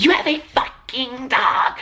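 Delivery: slow, high, weird mocking voice; imitating the dog guy who taunted her, slow and high. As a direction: mimic the creepy guy, drawn out high. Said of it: "his head was, like, twitching".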